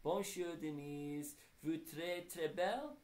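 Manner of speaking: put-on playful French accent, projected. A narrator's reading. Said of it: "If you say anything in a French accent".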